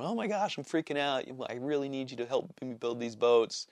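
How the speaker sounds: panicked customer. Retold or imitated. Customer emailing for help with boat builds, mildly acted panic. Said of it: "my other customer was emailing me going".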